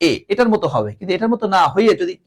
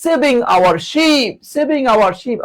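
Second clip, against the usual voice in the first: high, stretched cries for help; mock plea from a sinking ship; acted distress call; 6 semitones higher, much slower than usual. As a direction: wail for rescue, hammed up for class. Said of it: "কঠিন ভয়ঙ্কর বিপদে যখন পড়ে যায়".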